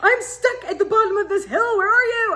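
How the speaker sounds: high, loud wail for help; frantic yelling. Acted. Stranded wife calls to husband, mock-desperate high yell. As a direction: holler up from the bottom of a hill.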